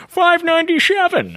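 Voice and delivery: high, drawn-out order call. Retold or imitated. Counter worker announcing chicken tenders order, calling out. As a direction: sing out the number across the room. Order caller shouting out numbers.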